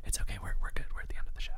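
hushed voice, barely voiced; whisper. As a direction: whisper it to the group. Group whispering show end.

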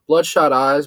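plain reading voice

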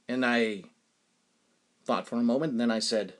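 ordinary talk, nothing marked

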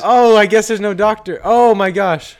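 mock despair. Improv bystander despairs.

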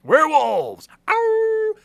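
loud high shout, then a wolf howl; hammed-up werewolf cry; excited howl. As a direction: belt it out like a werewolf.

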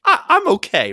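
high, laughing "I'm okay"; nervous laughing brush-off; laughing protest. Retold or imitated. Patient protesting to doctor, laughing.

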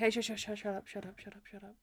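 rapid repeats fading to very soft; retold hush, sinking to near whisper; imitated shushing. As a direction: act out absently hushing someone, trailing away. Acting out Monk shushing Natalie.

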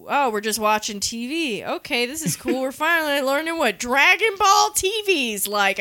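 normal talking voice

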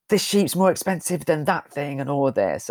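high, hammed-up farmer ranting; angry farmer. Farmer rants about his sheep, mock yell. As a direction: play the outraged farmer pointing at his sheep. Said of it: "farmer shouting about sheep".

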